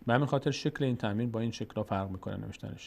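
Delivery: usual speaking voice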